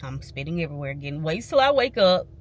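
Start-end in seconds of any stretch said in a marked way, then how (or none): 1.2-2.3 louder and higher, venting frustration; loud frustrated restatement about her mother; exasperated repeat. Real, not acted. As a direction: hit it harder the second time, fed up. Repeats phrase, punched harder.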